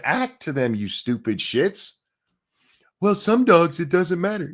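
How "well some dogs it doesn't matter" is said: high-pitched mock protest; put-on higher voice for a weak excuse; mocking objector. Streamer parroting people who argue back, mocking.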